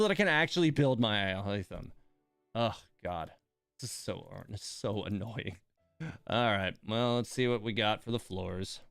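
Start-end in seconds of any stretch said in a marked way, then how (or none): none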